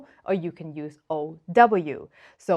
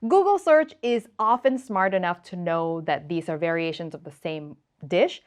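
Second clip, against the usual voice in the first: ordinary delivery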